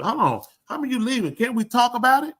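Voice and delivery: desperate plea. Retold or imitated. Husband begging leaving wife to talk it over, desperate. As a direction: beg her not to go.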